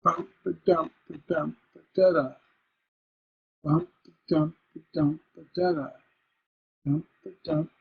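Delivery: repetitive chant, each line ending weakly on "da"; insistent beat with "DA-da" endings; feminine endings building tension. Shown as a demonstration. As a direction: stress "dump", leave final "da" unresolved. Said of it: "Do you hear how I'm creating tension?".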